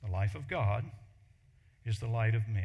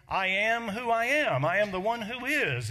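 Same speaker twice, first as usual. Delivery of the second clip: louder, higher declaration; proclaiming God's name; 7 semitones higher, 4 dB louder than usual. Acted. Preacher declaring God's self-given name, loud and high. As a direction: announce I am who I am.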